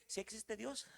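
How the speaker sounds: hushed, mock-doubtful little voice; small doubting voice. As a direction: let the bold faith shrink to a timid question. Acted doubt after shouted faith.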